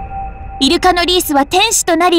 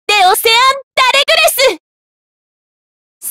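high, climactic spell call; attack cry; 7 semitones higher, much faster than usual. Acted. Game heroine unleashing her special attack, high cry. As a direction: raise the voice to release the spell.

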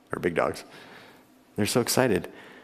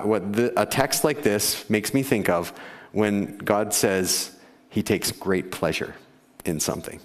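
ordinary talk

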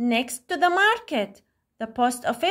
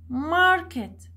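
"Market" with MA stressed, higher pitch; 4 semitones higher than usual. Shown as a demonstration. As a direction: punch the MA of "Market", drop the end. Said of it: "It's ma".

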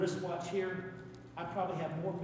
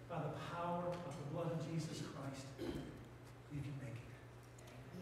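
hushed assurance; 3 semitones lower, 8 dB quieter, slower than usual. Acted. Pastor encouraging congregation.

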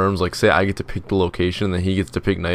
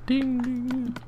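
high, soft, ringing "ding"; imitated ding; 7 dB quieter than usual. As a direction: make a tiny high chime noise. Imitates a ding while building.

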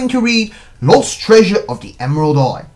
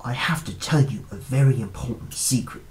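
low, hushed confiding voice; secretive; 5 semitones lower, 9 dB quieter than usual. A narrator's reading. Sister confides a secret to brother, secretive. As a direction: tell it softly like a secret.

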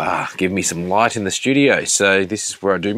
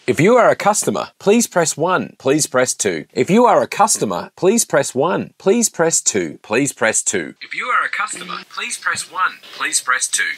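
higher, polished automated menu read; phone menu voice; 6 semitones higher than usual. Shown as a demonstration. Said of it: "I'm about to do these voiceovers".

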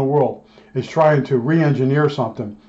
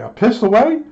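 incredulous echo; 7 semitones higher than usual. Retold or imitated. Indignant retort to accusation.

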